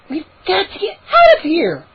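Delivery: high, frightened cry; panicked cry. Acted. Mindy grabs arm in panic.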